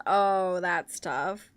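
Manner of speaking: ordinary talk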